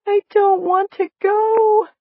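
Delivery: high, loud, drawn-out wail; slow, tearful high-pitched plea; moaned. A narrator's reading. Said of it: "she moaned to the big man".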